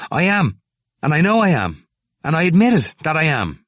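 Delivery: high, loud, insistent self-reproach; despairing. A narrator's reading. Temple confessing his failing, in worked-up despair. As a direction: pile up the admissions, pitched right up. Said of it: "shaking his head in despair".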